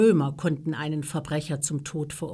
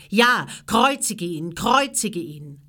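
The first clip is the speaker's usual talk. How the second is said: loud, drawn-out cry; chant-like demand, loud and insistent; crowd's shout; 3 semitones higher, 6 dB louder than usual. A narrator's reading. Priests urging Pilatus to crucify Jesus, shouting. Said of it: "schrien sie alle".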